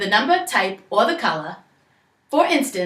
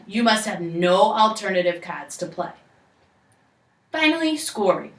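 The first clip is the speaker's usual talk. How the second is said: ordinary delivery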